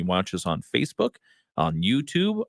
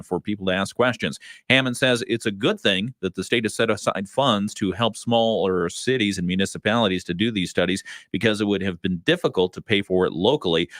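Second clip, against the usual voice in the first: measured radio newsroom delivery; news read. Real, not acted. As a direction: read it as a local news anchor. Reads the local news.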